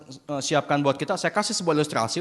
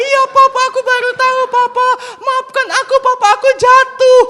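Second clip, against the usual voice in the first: put-on very high, loud little-boy wail; pleading child; 12 dB louder than usual. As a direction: beg dad in a hammed-up toddler voice. Dangling son apologises.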